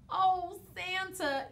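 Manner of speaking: high, loud, mournful wail; a wailing snowman, high and loud. A narrator's reading. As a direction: cry it out like a heartbroken snowman. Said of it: "he wailed".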